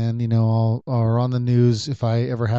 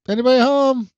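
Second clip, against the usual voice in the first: high-pitched raised call, last word stretched; yelling upstairs; 12 semitones higher, 5 dB louder than usual. Retold or imitated. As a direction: shout up to whoever might be upstairs. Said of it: "I'm yelling upstairs".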